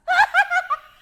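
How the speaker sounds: loud high-pitched shriek of laughter; real cackling shriek at her own joke. Real, not acted. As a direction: let the laugh burst out high.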